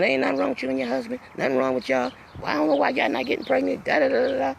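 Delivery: lightly voiced doctor, high and fast; doctor droning on. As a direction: rattle off the doctor's words dismissively. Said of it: "Then he kept saying".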